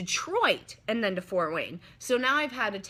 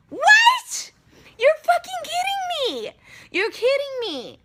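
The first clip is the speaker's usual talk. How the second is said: shrieking high and loud in disbelief; a shocked shriek that fades as it goes; outraged shout; 8 dB louder than usual. Real, not acted. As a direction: scream it like you can't believe it. Reacts to no hotel rooms.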